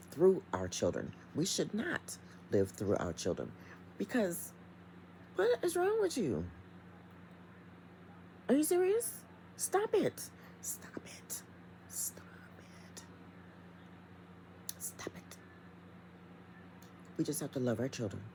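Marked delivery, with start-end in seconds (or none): 4.0-10.2 exasperated scolding. Acted. Scolding parents who live through kids. 10.6-13.0 breathy whispered repeat; whispered stop it. Acted. Whispered scolding to controlling parents. 14.8-15.4 voiceless whisper; whispered stop it. Acted. Whispered final scolding to controlling parents.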